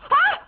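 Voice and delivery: sharp high shriek of frustration; teen shrieks at her parents; frustrated shriek. Acted. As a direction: let out a short furious squeal. Frustrated outburst.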